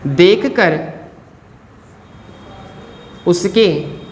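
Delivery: word by word, long pauses between; writing-pace dictation. Real, not acted. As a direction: dictate slowly as you write it out. तो यहाँ पे मैं लिख दूँ.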